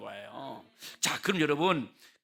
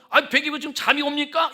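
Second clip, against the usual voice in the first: mock complaint; 7 semitones higher, 5 dB louder than usual. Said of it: "이렇게 말할 게 아니라".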